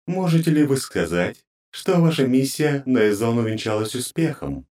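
high, grating divine voice; cold goddess interrogating her servants; inhuman goddess voice. Acted. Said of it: "вибрирующие гармоники".

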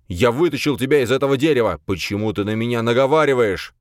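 loud protest of the wrongly accused; outraged roar. A narrator's reading. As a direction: hurl the accusation back, wounded and angry. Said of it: "взревел Хранка".

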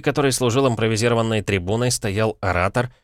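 plain reading voice

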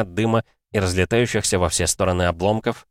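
ordinary delivery, nothing marked

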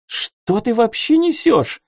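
exclaiming princess. A narrator's reading. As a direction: snap back in disbelief. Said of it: "воскликнула Армки".

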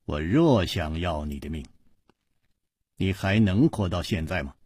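low, subdued, icy menace; chilly low-voiced rebuff; cold. A narrator's reading. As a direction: say it coolly and quietly, without heat. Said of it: "黑衣人冷冷地说".

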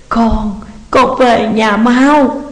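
drawn-out, slow tearful call to hurry home; begging her son to come back now; sobbing plea. A narrator's reading. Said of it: "đang gào khóc thảm thiết". (mẹ khóc gọi con về nhanh)

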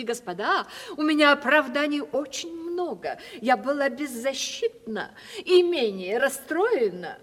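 usual speaking voice

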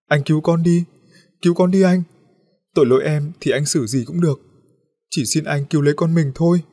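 high, loud tearful begging; sobbing plea. A narrator's reading. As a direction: beg her husband through sobs to save the child. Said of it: "vừa nói vừa khóc nức nở".

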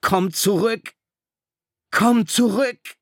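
high, loud desperate yell; screaming to the ship. A narrator's reading. Ralph screaming at the departing ship, desperate. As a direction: scream across the sea at the ship. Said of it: "schrie zum Schiff hinaus".

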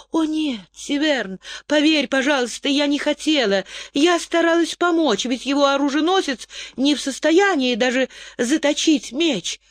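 lightly voiced young woman, hurried excuses; Zarit, excusing herself. A narrator's reading. Zarit begging brother to believe.

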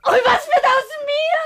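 crying shout, voice breaking. Acted. Pregnant woman crying out at her lover in despair.

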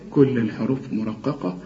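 ordinary delivery

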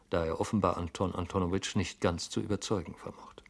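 ordinary delivery, nothing marked

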